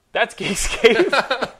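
show sign-off. Acted.

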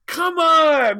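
high, loud falling shout; exasperated shout. Acted. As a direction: bellow it a second time, fed up. Tom protesting to Janeway.

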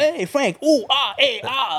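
high, hammed-up Italian-American guy; a cartoonish Italian-American voice, high and hammy; mock Italian-American. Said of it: "Like, like what?".

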